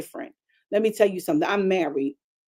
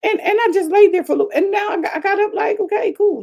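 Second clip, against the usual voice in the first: high and fast, bubbling over; excited; 12 semitones higher, 5 dB louder than usual. Real, not acted. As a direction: tell it almost laughing with excitement. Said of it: "excited retelling".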